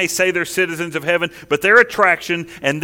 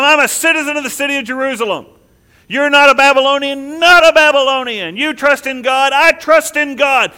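higher, louder, triumphant challenge; God calling bluff; 7 semitones higher, 7 dB louder than usual. Acted. Preacher voicing God inviting them home to Jerusalem. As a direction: cheerfully call their bluff, building up.

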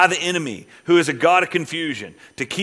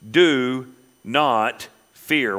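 slow reassurance; 2 semitones lower, much slower than usual. Acted. Voicing God, slow gentle reassurance.

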